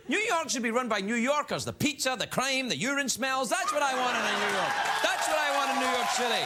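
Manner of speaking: a hint of a proud New Yorker; mock civic pride. Comedian mocks pride in New York, gently hammed boast. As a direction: boast it with a grin.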